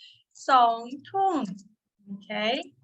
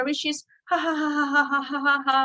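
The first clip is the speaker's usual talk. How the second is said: ha ha ha as a playful laugh; teacher's playful ha ha ha; mock laughter; 3 semitones higher than usual. Shown as a demonstration. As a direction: laugh it out to show the word. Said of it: "a laughter".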